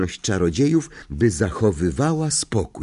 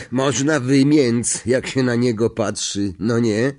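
lively slangy conductor's voice; chatty bus conductor; 4 semitones higher than usual. Acted. Stan comments to Harry on a wanted man's picture, chatty conductor. Stan speaking, acted by narrator.

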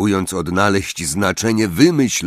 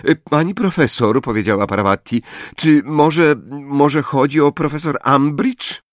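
Parvati; 7 semitones higher than usual. A narrator's reading. Parvati voiced, timid.

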